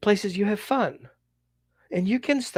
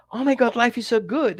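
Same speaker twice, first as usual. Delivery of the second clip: delighted dog; 6 semitones higher than usual. Acted.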